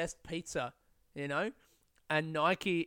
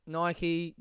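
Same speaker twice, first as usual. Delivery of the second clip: usual speaking voice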